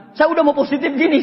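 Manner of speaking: normal talking voice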